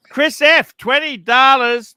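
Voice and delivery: high, loud announcer shout, slowed; super chat callout. Real, not acted. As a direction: call out the donation with a big voice. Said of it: "Super chat".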